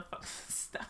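breaking into giggles; laughing too hard to talk; hiccup giggles. Real, not acted. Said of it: "I'm gonna laugh too hard".

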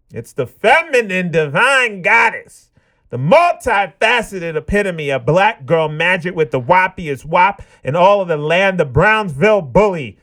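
ring-announcer build to a long, high final name; hype announcer. Acted. Host introduces his co-host Star, hype announcer style.

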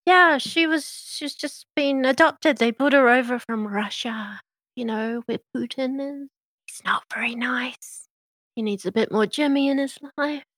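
high, bubbly, gossipy small-town waitress; rattling on brightly about the kitchen girl; chatty Meadow. Acted.

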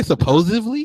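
supposedly echoed high as "supposively?"; incredulous "suh-POSE-iv-lee" for supposedly. Retold or imitated. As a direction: say supposedly with a V, in rising disbelief. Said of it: "with a V".

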